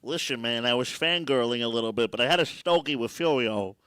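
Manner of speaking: loud mobster confession; Tony Soprano. Pretend. Comic telling friends about fangirling, mobster voice. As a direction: confess it as a TV mob boss. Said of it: "like you're telling your therapist".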